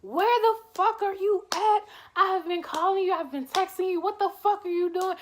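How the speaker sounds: imitating an angry wife yelling; wife screaming. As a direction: shout it like a furious wife demanding answers. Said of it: "my wife is loud as hell screaming".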